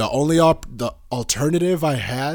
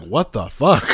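mock outrage; 6 dB louder, slower than usual. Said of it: "I'm like".

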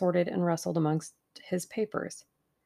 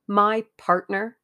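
slow, louder, with measured dignity; dignified; 3 semitones higher, 8 dB louder, slower than usual. A narrator's reading. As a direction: stand on your dignity, spacing the words. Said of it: "said Tuppence with dignity".

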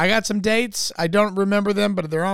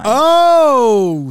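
mock gasp; 8 semitones higher, 10 dB louder than usual. Mock amazement at reveal.

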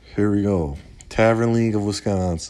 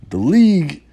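loud emphasis; 9 semitones higher, 9 dB louder than usual. Real, not acted. Sudden emphatic burst.

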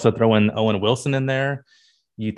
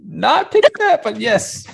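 high-pitched, louder surprised squeal; 13 semitones higher, 4 dB louder, slower than usual. Real, not acted. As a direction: voice shoots up in surprise. Surprised reaction.

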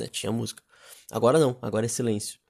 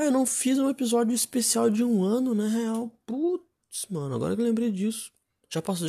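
surprised; 8 semitones higher than usual. Real, not acted. Just remembered missed anniversary.

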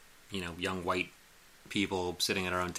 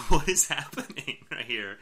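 louder, laughing bewildered question; laughing disbelief; 6 semitones higher, 7 dB louder than usual. Retold or imitated. Woman behind them reacts to restaurant cheering, laughing disbelief. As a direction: laugh through the confusion. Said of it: "and the girl goes, like".

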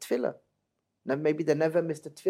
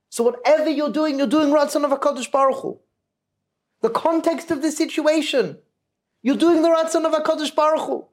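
much higher, loud, fervent urging; impassioned plea; 9 semitones higher, 4 dB louder than usual. Retold or imitated. Zusha to his weeping brother in jail, fervent and loud. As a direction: insist with rising fervor that it is G-d's will.

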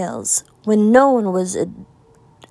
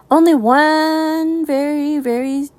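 wavering thought; 5 semitones higher than usual. Acted. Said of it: "he thought".

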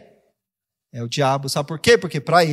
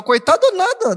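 much higher, punchy retort; emphatic retort; 12 semitones higher, 3 dB louder than usual. Retold or imitated. Son answering his pitying mother, high emphatic. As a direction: throw it back at her forcefully. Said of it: "Eu falo".

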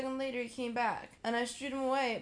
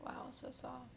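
swooning whisper; 3 semitones higher, 9 dB quieter than usual. Pretend. Swooning over soft boy.

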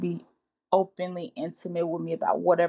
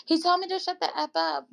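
imitating a complaining sister; 9 semitones higher than usual. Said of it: "I've had a sister that tell me".

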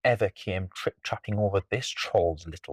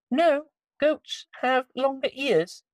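very high little mouse; squeaky mouse voice; 3 dB louder, slower than usual. Acted. Mouse corrects troll.

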